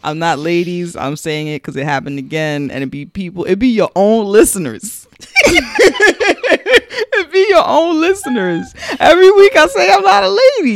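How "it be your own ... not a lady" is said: high, loud laughing complaint; laugh-cry through the frustration; laughing exasperation. Real, not acted. Exasperated about listeners, laughing.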